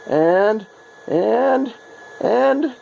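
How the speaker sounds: building suspense. Real, not acted. Building suspense before reveal.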